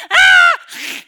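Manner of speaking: sudden shrieking scream of a swimmer; piercing mock scream. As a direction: scream as the shark grabs you. Swimmer grabbed by shark.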